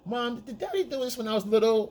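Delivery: put-on high, childlike asking; child voice. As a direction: ask it like a little kid. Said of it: "the kids will say".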